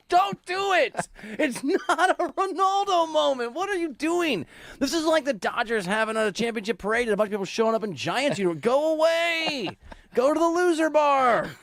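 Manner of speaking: high, drawn-out protesting wail; mock outrage. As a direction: scold a teasing co-host in hammed-up exasperation. Mock outrage at co-host's teasing.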